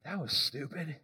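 low, soft scoffing aside; scornful onlookers. Retold or imitated. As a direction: mutter scorn at a silly plan. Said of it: "Everybody looks at it like".

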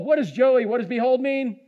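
ordinary talk

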